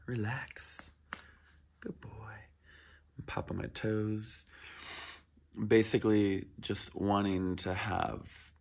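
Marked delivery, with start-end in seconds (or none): 0.1-2.1 soft whispered calming word; whispered relax. Real, not acted. Trainer whispers to the dog to relax. 2.1-4.5 whispered aside. Real, not acted. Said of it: "unvoiced slow aside".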